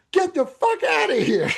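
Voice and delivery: high mock shout to chase him off; retold angry yell. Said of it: "He goes".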